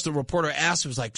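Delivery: ordinary delivery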